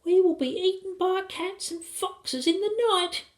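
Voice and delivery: put-on very high, whimpering child; high-pitched frightened child; tearful little boy. A narrator's reading. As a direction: whimper it in a piping voice. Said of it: "the boys began to cry a bit".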